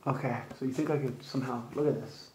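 muffled behind box over mouth; speaking with face covered; talking through cardboard box. Real, not acted.

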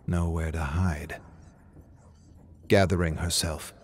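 ordinary delivery, nothing marked

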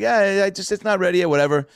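imitating Larry's excuse; Larry David demurring. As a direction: shrug it away. Larry voiced declining.

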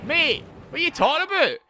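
high, loud Geordie outburst; Geordie accent. Acted. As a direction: protest loudly in a hammed-up Geordie accent. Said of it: "Wasn't that Geordie?".

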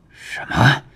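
short shocked question; startled. A narrator's reading. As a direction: blurt it out in disbelief. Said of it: "唐庆一惊".

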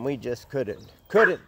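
normal talking voice

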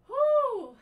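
loud high whoop; cheer. Real, not acted. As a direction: whoop to push through the burn. Cheering mid-workout burn.

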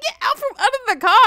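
very high, loud order; mock scolding shout. Grandma to child under the car, mock scolding. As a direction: imitate a frantic, squealed command.

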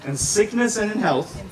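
loud, emotional vows to the bride; saying wedding vows. Real, not acted. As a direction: declare each vow loudly, paused. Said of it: "have you repeat".

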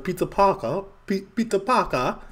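high, exaggerated posh British Peter Parker; over-the-top British "Peter Parker"; mock British accent. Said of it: "Brits be like".

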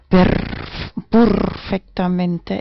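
"perfectamente" with a long rolled, purring R; purred R. Acted. Streamer praising her kitty voice, purring the R in "perfectamente". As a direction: draw out "perfectamente" slowly, trilling the first R like a purr.

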